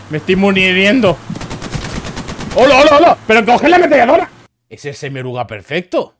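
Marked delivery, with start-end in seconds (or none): 2.6-4.3 high frantic shouts; panicked yelling. Real, not acted. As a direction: yell for help under fire. Panicked calls under fire.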